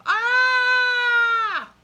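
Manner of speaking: long held high shouted ah; long high scream. Acted. A loud sound heard in the jungle, long scream. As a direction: let out a sustained loud cry.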